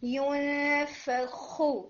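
ینفخ slowly with a rasping throat kha; teacher stressing kha; clear kha demo. Shown as a demonstration. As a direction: give the kha in ینفخ time. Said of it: "اتنا time دیں کہ اس کی آواز prominent ہو".

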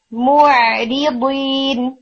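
موعدین drawn out very slowly, ain relaxed after the rounded meem. Shown as a demonstration. Tutor modelling موعدین for students, very slow. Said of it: "relax رکھنا ہے".

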